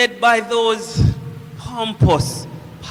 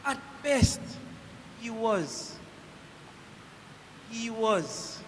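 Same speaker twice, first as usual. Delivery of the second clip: very soft, halting and trailing away; hushed uncertainty; 3 semitones lower, 14 dB quieter than usual. Acted. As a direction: falter softly, unable to finish the thought. At a loss for words.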